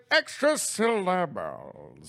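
slow, lightly drawn-out extra syllables; mild Matt Berry. Shown as a demonstration. Explaining the impression, slow mild Matt Berry take. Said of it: "Matt Berry has a very low and musical timbre".

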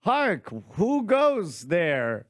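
put-on slow, theatrical old English herald; hammy stage actor. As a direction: declaim it like a stage player. Pilgrim quote in Chaucer bit.

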